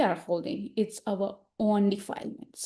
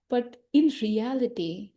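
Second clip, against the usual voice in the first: ordinary talk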